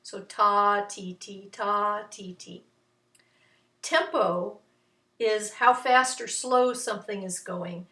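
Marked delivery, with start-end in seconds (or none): 0.2-2.6 long and short. Shown as a demonstration. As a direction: chant the rhythm evenly. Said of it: "ta for the long ones".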